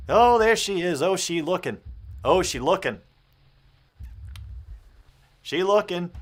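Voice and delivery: loud, amused calling out; excited reaction. Real, not acted. Gamer to chat about staring Yuri, loud amused. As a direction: hype up the creepy stare.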